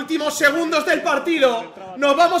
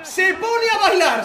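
laughing excited shout, much higher; breakneck goal commentary; 9 semitones higher, 8 dB louder than usual. Real, not acted. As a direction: yell it, thrilled and laughing. Keeper dancing after save.

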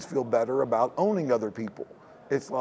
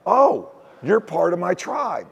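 delighted recognition; 4 semitones higher, 5 dB louder than usual. Pretend. Imagined greeting to fellow Texan.

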